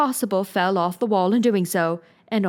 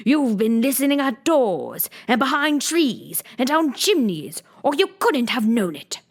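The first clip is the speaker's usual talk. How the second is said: higher, heated accusing rant; passionate accusation; 6 semitones higher, 3 dB louder than usual. A narrator's reading. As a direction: hurl the accusations in a rising temper. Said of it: "breaking into a sudden passion".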